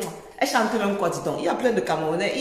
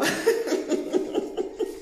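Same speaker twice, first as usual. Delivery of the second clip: laughing; 7 semitones higher, 4 dB quieter than usual. Real, not acted. Laughing at mocking comment.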